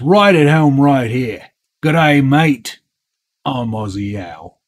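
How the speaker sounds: hammed-up Aussie greeting in character; Australian accent, not very good; mock Australian accent. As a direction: put on a playful, amateur Australian accent. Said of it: "the best Australian accent you're gonna hear tonight".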